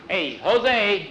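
loud, high hail; crying out. A narrator's reading. Miguel tells Jose it is time, crying out. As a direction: holler to get his attention. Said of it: "Miguel cried".

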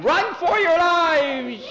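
very high, loud, slow drawn-out cry; hammed-up panicked alarm; panicked yell. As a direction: yell it as you bolt from the stall. Kid flees kicking horse again.

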